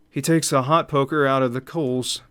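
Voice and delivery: ordinary talk, nothing marked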